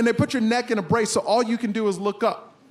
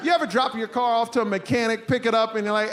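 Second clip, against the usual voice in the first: ordinary delivery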